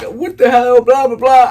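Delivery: loud, high mock freak-out; panicked inner thoughts. Good kid as the cop walks up, mock panic. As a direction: act out thoughts spiraling in alarm. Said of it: "This is what I'm thinking".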